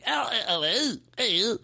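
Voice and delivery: high-pitched mock Cockney vowels; Cockney accent. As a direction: try out a silly Cockney sound. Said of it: "what's the accent".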